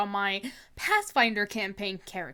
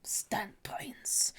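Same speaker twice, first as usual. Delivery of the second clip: dramatic whisper; 5 semitones lower, 12 dB quieter, slower than usual. Acted. Streamer reveals the mystery man in a whisper. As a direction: whisper the name like a secret.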